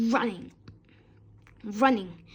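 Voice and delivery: usual speaking voice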